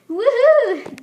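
loud high whoop; excited cheer. Pretend. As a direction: whoop for the winner. Cheering for the winner.

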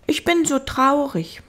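little prince. Acted. Little prince confiding in the fox, high child voice.

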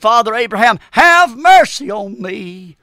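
pleading cry with long drawn-out words. Acted. Rich man in hell begging Father Abraham, crying out. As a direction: call out slowly for mercy, stretching each word. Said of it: "And he cried and said".

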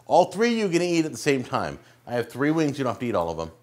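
ordinary talk, nothing marked

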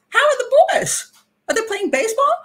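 high, bright, chatty voice, oddly cheerful; high sing-song small talk from the patient; chirpy Angela. Retold or imitated. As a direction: play her breezily cheerful after the ordeal. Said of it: "she goes".

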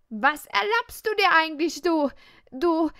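a loud, flustered outburst that stumbles on its last word; sputtering outrage. Acted. Girl fumes at the arrogant boy, sputtering outrage.

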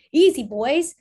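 steady them with a loud call; cried out. Acted. Father calling to oxen in the stream, loud call. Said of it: "cried Mister Lincoln".

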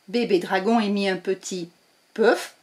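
ordinary delivery

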